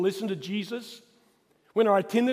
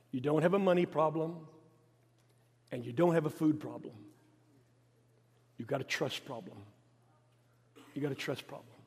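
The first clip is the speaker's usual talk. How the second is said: calm Jesus; 5 semitones lower, 5 dB quieter than usual. Retold or imitated. Jesus answers worried apostles again.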